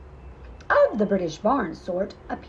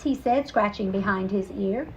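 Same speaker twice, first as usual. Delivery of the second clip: usual speaking voice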